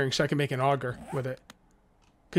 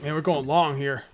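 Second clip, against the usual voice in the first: ordinary talk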